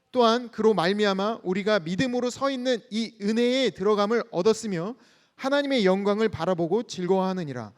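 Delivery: loud, steady unison reading of the verse; scripture reading. Acted. Pastor leading the church in reading scripture, loud and steady. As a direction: read it out together like a congregation. Congregation reads scripture aloud.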